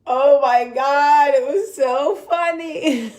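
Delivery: high, loud, drawn-out words through laughter; cracking up, barely getting the words out; real helpless laughter. Real, not acted. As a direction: wail it slowly through a big laugh. Cracking up at own story.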